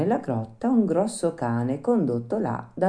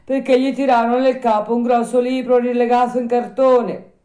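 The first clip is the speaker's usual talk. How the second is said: put-on higher, louder old man's voice; voiced old man, raised pitch; little old man; 11 semitones higher, 8 dB louder than usual. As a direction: tell the news as a village old man. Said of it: "rispose il vecchietto".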